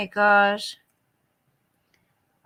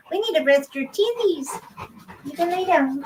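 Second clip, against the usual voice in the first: higher cooing pet voice with teethies said cutely; 6 semitones higher than usual. Real, not acted. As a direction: coo teethies at the dog. Baby talk to dog.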